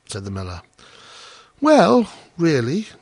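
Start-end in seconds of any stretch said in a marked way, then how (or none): 1.6-2.9 high, loud-starting grateful exclamation; delighted cry. A narrator's reading. Hans touched by the miller's question, delighted. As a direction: exclaim as the touched little gardener. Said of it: "cried Hans".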